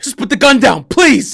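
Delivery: loud, high, forceful shout; shouted demand. Pretend. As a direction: yell it like you mean it. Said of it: "Now you kinda sound like".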